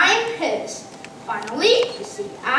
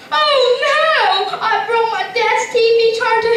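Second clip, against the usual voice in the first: higher, louder cry of dismay; mock dismay; 7 semitones higher, 5 dB louder than usual. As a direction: yelp as if the TV just broke. Breaks dad's TV.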